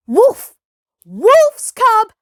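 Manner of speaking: high, loud, furious cry; priest shouting. Acted. Priest shouting at Mowgli while waving the tulsi. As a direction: drive him off with a shout. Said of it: "shouted the priest".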